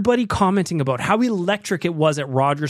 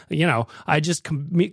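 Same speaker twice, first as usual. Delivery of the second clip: usual speaking voice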